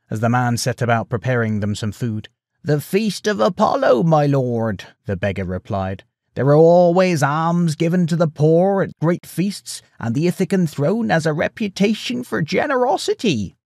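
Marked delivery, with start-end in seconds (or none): 2.6-4.8 high, creaky beggar's voice; creaking old beggar. A narrator's reading. Beggar answers the farmer who took him in, high creaky voice. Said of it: "no sign of creaking to his voice any more". 6.3-13.5 high-pitched, creaky beggar; creaking old beggar. A narrator's reading. Beggar praises Ithaca's alms to the pig farmer, high creaky voice. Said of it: "no sign of creaking to his voice any more".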